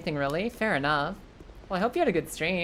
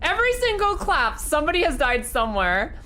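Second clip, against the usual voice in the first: high excited exclamation; 8 semitones higher, 4 dB louder than usual. Pretend. Hammed-up dramatic retelling.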